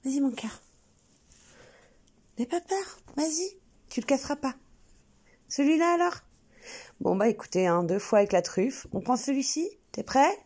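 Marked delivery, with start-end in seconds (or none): 0.0-6.2 high, sweet voice to encourage a nervous dog; high pet-talk coaxing. Real, not acted. Owner urging her hesitant dog, in high coaxing pet talk. 8.9-10.4 eager coaxing. Real, not acted. Urging dog to pick.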